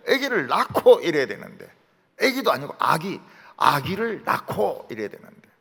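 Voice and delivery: usual speaking voice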